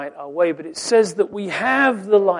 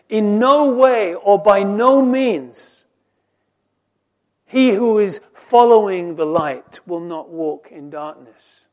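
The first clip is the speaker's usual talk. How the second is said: louder, slow on "In no way or by no means"; emphatic preaching; 4 semitones higher, 10 dB louder than usual. Acted. Preacher stresses the strong Greek wording, emphatic and slow. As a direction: drive home every word.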